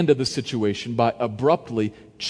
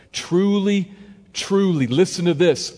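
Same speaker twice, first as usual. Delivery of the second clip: raised, slow and solemn; solemn earnest; 6 semitones higher, 4 dB louder, slower than usual. Acted. Jesus solemnly pressing Nicodemus, raised and slow. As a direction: press the truth home, gravely. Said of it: "deadly serious here".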